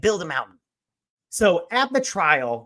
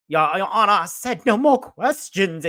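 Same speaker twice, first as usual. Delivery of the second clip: [name]'s mock Southern accent; 6 semitones higher, 5 dB louder than usual. [name] ending his questioning before the judge, mock Southern accent. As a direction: imitate a Southern lawyer addressing the bench. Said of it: "putting on this Southern accent".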